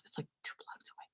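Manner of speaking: whispered, no voice at all; whispered aside. Real, not acted. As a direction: drop to a whisper first. Whispered aside, then said normally.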